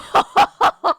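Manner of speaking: big high burst of laughter; loud laugh. Real, not acted. Retelling a weird workday, loud laughter.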